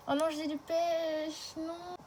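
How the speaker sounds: high, slow, let-down whine; disappointed whine. Real, not acted. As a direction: drag it out, crushed by bad luck. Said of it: "je suis dégoûtée".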